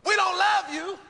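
mock mother outraged by her son; indignant shout. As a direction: throw his words back at him in disbelief. Mother repeating son's accusation.

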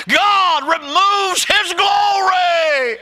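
high, loud slow whoop; preaching at full force. Acted. Preacher whooping to the congregation at the cross, high and loud. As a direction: stretch it out in a whoop.

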